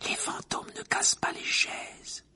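slow hushed whisper; slowly. Acted. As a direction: whisper it out word by word. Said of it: "dit-il lentement".

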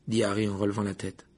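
usual speaking voice

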